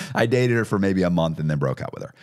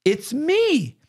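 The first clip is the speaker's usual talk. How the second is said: much higher, lightly mock-insistent; a hammed-up plea of innocence; insisting girlfriend. Girlfriend insisting to the title clerk, high mock-insistent voice. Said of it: "Allison, was like".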